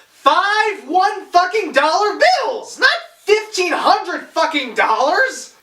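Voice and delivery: loud, high, indignant shout; angry. Real, not acted. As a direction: rage at the prop money. Ranting about the five dollars.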